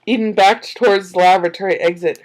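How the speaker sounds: ordinary delivery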